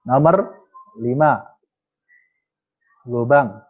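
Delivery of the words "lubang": normal talking voice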